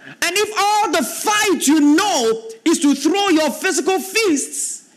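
high, slow, ringing pulpit preaching; fervent preacher building to a peak; preaching at full force. Acted. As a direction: lift the scripture up and thunder it out slowly. Preacher reading scripture, rising.

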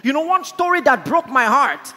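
high, loud impassioned preaching; forceful, high-pitched sermon delivery; preaching at full force. Real, not acted. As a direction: drive it home to the whole congregation.

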